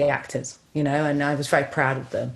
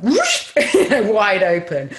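louder, slower words through laughter; laughing while telling; 4 semitones higher, 6 dB louder, slower than usual. Real, not acted. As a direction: laugh as the doors fly open. Laughs describing doors opening.

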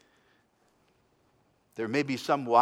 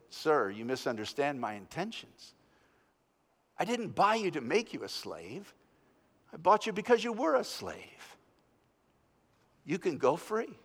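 calm reassurance; 6 dB quieter than usual. A narrator's reading. Gentle reply to enraged slave.

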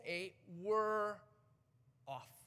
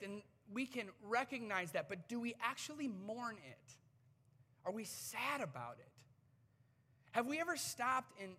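usual speaking voice

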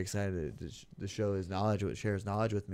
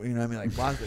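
normal talking voice

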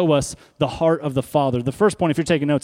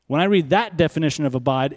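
ordinary delivery

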